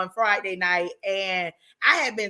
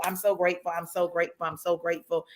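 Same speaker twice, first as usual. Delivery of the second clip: soft, lower, fading gratitude; tender repeated thanks; 4 semitones lower, 6 dB quieter, faster than usual. Real, not acted. Host thanking her guest at the end, softly. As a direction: let it settle quietly. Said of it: "I'm grateful".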